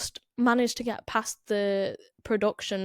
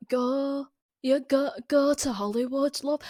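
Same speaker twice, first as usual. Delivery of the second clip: higher-pitched imitation of a bad Northern English accent; mock Northern accent; 5 semitones higher than usual. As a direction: overdo the Northern English O sound in "Go". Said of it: "she just starts going".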